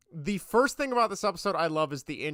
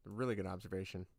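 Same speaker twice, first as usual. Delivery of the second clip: much softer, quicker repeat of "A really good observation"; "A really good observation" said again as a soft, quick, lower aside; quiet fast echo; 12 semitones lower, 13 dB quieter, faster than usual. Shown as a demonstration. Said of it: "That's, that's a really good observation".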